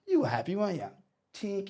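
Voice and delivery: high coach impression; imitating the coach. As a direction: needle the player as a high-voiced coach. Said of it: "He goes".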